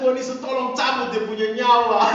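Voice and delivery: loud, high hammed-up plea; mock vengeful prayer. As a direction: cry out a mock curse as a prayer. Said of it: "Ada yang sakit hati berdoa begitu".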